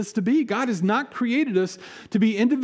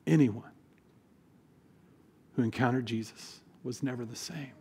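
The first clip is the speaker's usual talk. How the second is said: much softer, pausing after Anyone; hushed repeat; 9 semitones lower, 9 dB quieter than usual. Real, not acted. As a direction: let it sink in, almost under the breath. Repeats key line softly.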